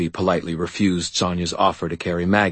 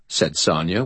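plain reading voice

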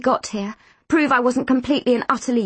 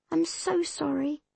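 soft, slightly higher-pitched apology; a hushed sorry; 6 semitones higher, 7 dB quieter than usual. A narrator's reading. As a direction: apologise quietly, in earnest. An apology voiced in a story.

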